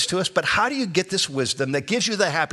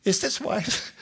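"Is this wise" high and wondering, pointedly inflected; exaggerated questioning inflection; 7 semitones higher, slower than usual. Shown as a demonstration. Pastor demonstrating his inflection to the congregation, high questioning "Is this wise". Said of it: "Do you notice my inflection".

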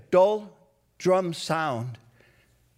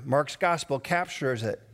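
normal talking voice

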